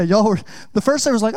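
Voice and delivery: ordinary delivery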